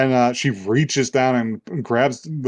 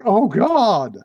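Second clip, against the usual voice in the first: mock horror; 8 semitones higher, 3 dB louder than usual. Voices character seeing the scar.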